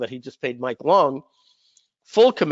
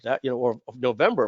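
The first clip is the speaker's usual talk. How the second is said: ordinary talk, nothing marked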